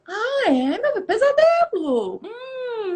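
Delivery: high, drawn-out motherly cooing; sympathetic mother. Retold or imitated. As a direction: coo with exaggerated interest to your child. Mãe reage ao pesadelo do filho. (mãe acolhedora, com voz aguda e arrastada)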